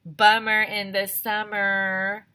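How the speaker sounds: loud, slow sing-song; playful bummer chant; catchphrase. A narrator's reading. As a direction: drag it out glumly. Child's catchphrase.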